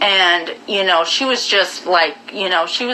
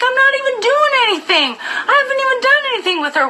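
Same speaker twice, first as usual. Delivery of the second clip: much higher, whiny mock protest, rushed with a laugh; mocking the boyfriend; faster than usual. Imitating the boyfriend protesting his innocence to her. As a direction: squeak out his excuse fast and high. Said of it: "He's like".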